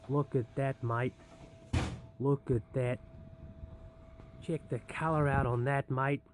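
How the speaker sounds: playful mock-Australian accent. Vlogger showing off his bake to viewers, mock Australian accent. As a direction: put on an Aussie accent, admiring. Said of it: "speaking in an Australian accent".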